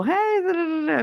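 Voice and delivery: high, singsong fake-happy hello; mock cheery greeting. Everybody's supposed to act fine, mock cheery. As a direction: chirp a hollow, breezy greeting. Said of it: "everybody's supposed to be all".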